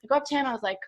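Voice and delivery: ordinary delivery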